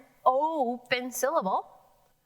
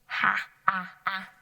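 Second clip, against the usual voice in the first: 1 short a demo. Shown as a demonstration. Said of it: "That is a short A sound".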